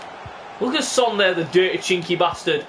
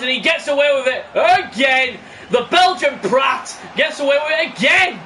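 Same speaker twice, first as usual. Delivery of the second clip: outraged yell, higher and louder; angry shout; 7 semitones higher, 4 dB louder than usual. Real, not acted. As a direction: shout in disbelief at the foul. Fouls go unpunished, shouting.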